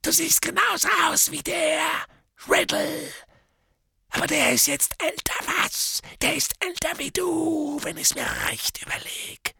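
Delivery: high, cracked mad voice; a deranged drunken hermit; crazed drunk. Acted. Morphin's voice.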